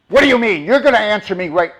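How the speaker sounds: loud, high, outraged shouting; angry yelling demand. Shown as a demonstration. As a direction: bark back like someone demanding answers. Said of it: "That's how people come at you".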